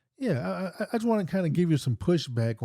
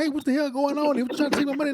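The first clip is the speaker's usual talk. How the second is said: hammed-up cry of a victim being robbed; imitated victim yell; 14 semitones higher, much faster than usual. Co-host acting out how a real victim would shout, mock panicked yell. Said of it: "I would assume normal victims".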